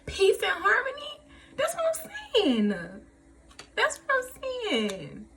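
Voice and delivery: overflow with joy at the reading; dramatic delight; emotional excitement. Real, not acted. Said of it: "I'm being real dramatic".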